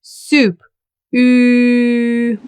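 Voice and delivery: Soup with oo, then Ü with lips rounded. Shown as a demonstration. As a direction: move from soup's oo to Ü. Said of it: "So let's try it".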